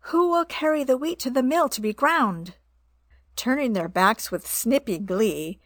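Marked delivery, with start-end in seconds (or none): 0.1-2.6 higher clucking hen call. A narrator's reading. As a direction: call out to the lazy animals. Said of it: "Then she called out".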